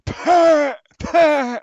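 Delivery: high, loud, strained Frank Butcher growl; Frank Butcher impression. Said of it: "basically the best Frank Butcher impression".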